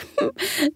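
light tinkling fairy giggle; a tinkly bell-like laugh. A narrator's reading. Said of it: "let out a tinkling laugh".

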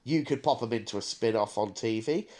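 ordinary talk, nothing marked

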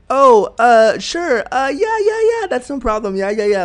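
high, too-quick reassurance; mock high-pitched "sure, no problem" hiding discomfort; uncomfortable over-eagerness. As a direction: imitate someone agreeing way too readily. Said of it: "I can hear their discomfort".

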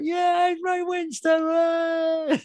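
very high, loud mock fan cheer; cheering crowd. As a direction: imitate fans hailing their hero. Acting out the cheering fans.

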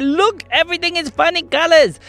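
high, wide-eyed exclamation; excited discovery. Acted. Girls seeing a colorful place, high excited voice. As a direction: cry out high in wonder.

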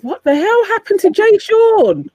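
surprised. Real, not acted. Shocked outburst about [name].

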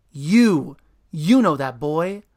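loud pointed accusation; accusing. Acted. Super accusing Stefan, loud and stern. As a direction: jab the words at the boy.

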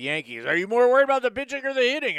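mock sports radio host; sports talk host. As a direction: ham up the call-in show host. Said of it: "Sports talk guy".

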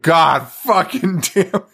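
imitating an enraged professor's curse; furious. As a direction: draw out each furious word. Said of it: "fucking furious".

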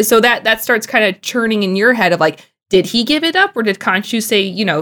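ordinary delivery

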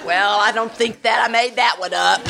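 amused disbelief, chuckling as you say it; laughing. Retold or imitated. Amused realization about answered prayer.

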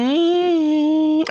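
long, very high mock "mm"; squealed, drawn-out hum imitating a lover; cringy high moan. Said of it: "she goes".

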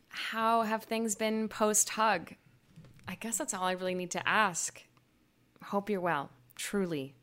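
letter read aloud. Acted. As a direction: read a tender letter, unhurried. Reading her letter aloud.

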